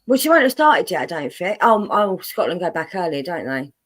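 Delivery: ordinary talk, nothing marked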